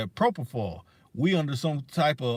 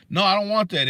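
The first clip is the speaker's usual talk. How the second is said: firm refusal; 6 semitones higher, 6 dB louder than usual. Real, not acted. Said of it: "refusing the pitch".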